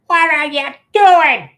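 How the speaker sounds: high, loud, slow shout; shouted question. Retold or imitated. Sister catching boys in yard, high slow shout. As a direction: call out from above, catching them. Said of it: "I hear this".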